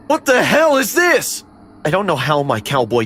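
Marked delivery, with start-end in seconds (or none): 0.1-1.4 put-on startled drunk stepdad. Stepdad startled then disgusted.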